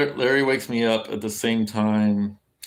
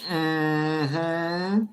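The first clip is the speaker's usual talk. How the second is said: a higher, drawn-out mock sassy mm-hmm; 6 semitones higher than usual. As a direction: hum a knowing sassy agreement, hammed up. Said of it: "they like to go".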